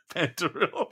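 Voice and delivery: laughing. Real, not acted. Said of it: "I'm so sorry".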